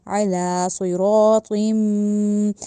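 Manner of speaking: सुइरातिन chanted heavy with rounded lips; slow recitation. Shown as a demonstration. Teacher recites the correct verse for students, slow chant. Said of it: "सिरातिन गलत है".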